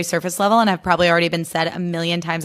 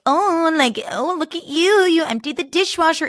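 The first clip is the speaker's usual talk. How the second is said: put-on much higher, cooing, talking down to a toddler; patronizing baby talk; 10 semitones higher than usual. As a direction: coo like praising an infant. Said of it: "so patronizing".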